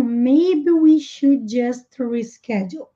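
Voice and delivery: very slow, every word laid out; slow teacher model sentence. Shown as a demonstration. Teacher to Portuguese students, very slow clear model. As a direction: spell it out in speech for the class. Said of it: "E um maybe".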